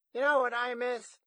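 high, loud grandma voice; high-voiced grandmother reminiscing; old grandma. A narrator's reading. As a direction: pitch it way up as a rambling granny. Grandma figure speaks in story.

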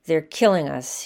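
ordinary delivery

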